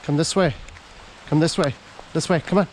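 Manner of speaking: high loud call to the dog. Real, not acted. Calling dog in rain.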